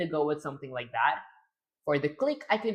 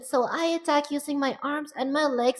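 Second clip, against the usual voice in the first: higher, put-on female pitch; more feminine voice; 9 semitones higher than usual. Shown as a demonstration. Streamer retries her attack line, higher feminine voice. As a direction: raise it further into a girlish register. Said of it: "a higher pitch".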